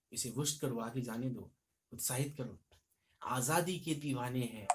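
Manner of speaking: rousing heroic recital; poem recitation. Shown as a demonstration. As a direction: chant it like a war anthem. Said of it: "उनका कहना है".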